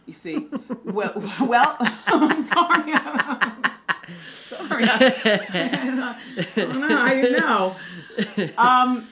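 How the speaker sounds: breaking up with loud laughter, apologizing through it; laughing fit. Real, not acted. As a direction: laugh so hard the words barely get out. Host cracks up mid answer.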